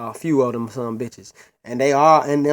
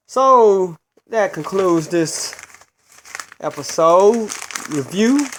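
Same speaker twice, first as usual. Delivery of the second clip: higher, louder show-closing announcement; announcer sign-off; 4 semitones higher, 6 dB louder, slower than usual. Acted. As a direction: stretch out the phrases like a host signing off.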